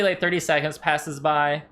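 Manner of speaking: ordinary talk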